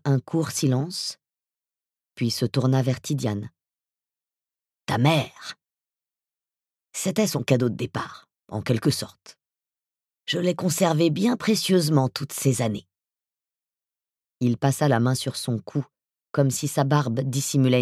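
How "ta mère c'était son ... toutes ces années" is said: voice from beyond the grave. Acted. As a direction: speak like a menacing ghoul. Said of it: "sa voix d'outre-tombe".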